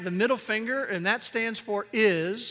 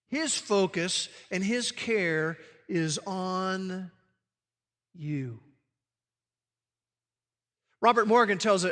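usual speaking voice

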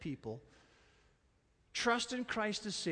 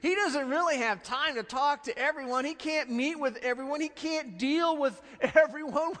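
mock-officious gatekeeper, louder and higher; imitating fussy disciples; 8 semitones higher, 5 dB louder than usual. Disciples shooing away parents, mock indignant. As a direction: shoo people off like a self-important aide. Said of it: "The disciples go".

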